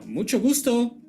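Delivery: ordinary talk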